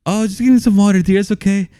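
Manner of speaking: high-pitched mock reassurance; imitated movie character. Acts out movie character speaking.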